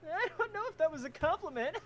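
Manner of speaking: high, laughing as she speaks. Acted. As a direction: laugh through the line. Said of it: "Saffron laughs a bit".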